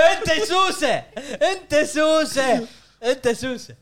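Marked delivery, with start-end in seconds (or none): 0.1-2.7 high, slow, laughing through the words; teasing laugh. Real, not acted. Teasing a friend on the podcast, laughing. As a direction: laugh while calling him a pest.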